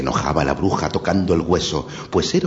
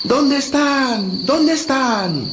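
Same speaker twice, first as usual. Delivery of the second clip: put-on high, slow child calling out; lost children calling for their sheep; much slower than usual. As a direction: call into the dark woods, slowly. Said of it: "repetían".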